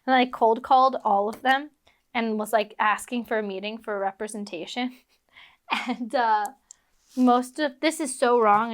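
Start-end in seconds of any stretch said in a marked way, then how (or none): none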